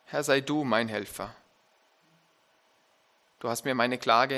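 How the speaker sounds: measured, solemn reading of the psalm; scripture reading. Acted. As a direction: read the psalm aloud to the congregation with weight. Reads Psalm 30 aloud.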